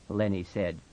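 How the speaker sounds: ordinary delivery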